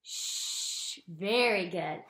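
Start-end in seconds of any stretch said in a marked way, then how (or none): 0.0-1.0 "Shh" hushed, breath only; a long quiet shush; shh sound. Shown as a demonstration. Teacher modelling the sh sound for kids, hushed. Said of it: "S H says".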